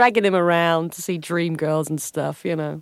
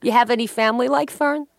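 Long Island housewife; 7 semitones higher than usual. Acted. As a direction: demand an answer, calling her darling. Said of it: "Yeah, Fern".

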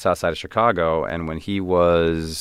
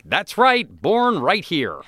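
put-on goofy American kid; 12 semitones higher, slower than usual. Answering the consulate staff as a high, goofy American kid. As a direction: play the big naive American tourist. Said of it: "I'm like".

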